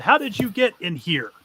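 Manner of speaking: a bandit shouting at the party; shouted demand. Acted. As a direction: snap the question at the intruders. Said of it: "he shouts it".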